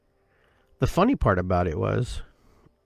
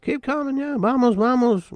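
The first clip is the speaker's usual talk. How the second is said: much higher, cheering him on; encouraging hikers. Retold or imitated. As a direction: urge him upward in a high, cheering voice. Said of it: "encouraging me to go".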